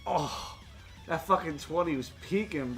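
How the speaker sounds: ordinary talk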